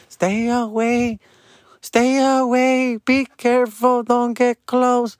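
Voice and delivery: high, slow drawn-out warnings; fussy staff warning. Retold or imitated. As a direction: warn them off like a nervous guard. Staff warning parents again.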